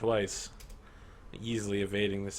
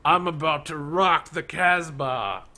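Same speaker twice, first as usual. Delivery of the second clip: slow, raised, loud swaggering warrior; villain voice; 7 semitones higher, 8 dB louder, slower than usual. Acted. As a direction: draw out the taunt slowly. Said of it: "and he says".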